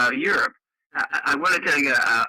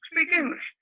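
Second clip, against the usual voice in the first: insistent demand; slower than usual. Retold or imitated. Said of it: "and I said".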